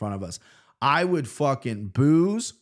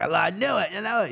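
higher-pitched mock English accent; 7 semitones higher than usual. English fan recognizing Big Gun, imitated English accent. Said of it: "That's my English accent".

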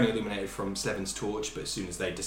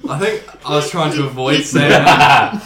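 loud, higher, breaking into laughter; laughing; 6 semitones higher, 18 dB louder than usual. Real, not acted. Game master admitting a slip in the narration, laughing loudly. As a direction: crack up over your own clumsy wording.

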